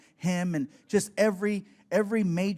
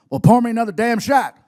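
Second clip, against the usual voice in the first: gruff shout; 5 semitones higher, 12 dB louder than usual. Retold or imitated. Stone Cold orders.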